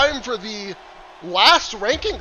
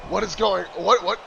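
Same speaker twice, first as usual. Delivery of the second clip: ordinary talk, nothing marked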